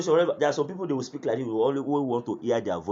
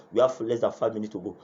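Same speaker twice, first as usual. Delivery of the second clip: ordinary talk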